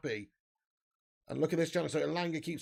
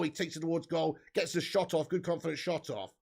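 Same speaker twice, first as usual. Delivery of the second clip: ordinary delivery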